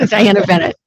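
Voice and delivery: saying her name through a laugh. Real, not acted. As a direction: laugh it out, embarrassed. Laughs while stating her name.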